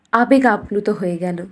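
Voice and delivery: usual speaking voice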